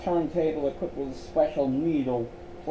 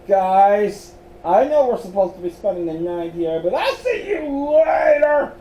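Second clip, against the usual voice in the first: louder, higher scared cry; panicked shout; 6 semitones higher, 7 dB louder than usual. Acted. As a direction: yell it out while bolting for the door. Miles panics and flees.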